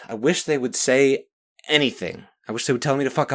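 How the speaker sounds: high, loud exasperated outburst; real anger boiling over; getting upset. Real, not acted. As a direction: vent frustration at full tilt. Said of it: "I'm getting upset".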